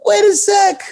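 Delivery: very high, squeaky falsetto yelp; voice shooting up. Retold or imitated. Boxer recalls his shorts yanked into his crotch, voice shooting up. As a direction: jump way up into a pained falsetto. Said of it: "it definitely made my voice go up".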